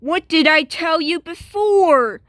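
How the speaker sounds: normal talking voice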